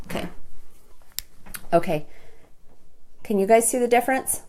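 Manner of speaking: normal talking voice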